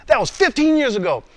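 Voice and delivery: high-pitched acted objection; protesting. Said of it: "listen to my responses".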